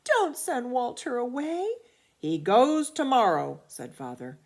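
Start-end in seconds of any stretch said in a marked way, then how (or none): 0.0-1.7 put-on higher, louder begging child; pleading. A narrator's reading. Children begging father to keep Walter, high and loud. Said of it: "begged Betty and Billy". 2.2-3.6 loud, unbending dad; stern final decree. A narrator's reading. Father overruling the begging children, loud and stern.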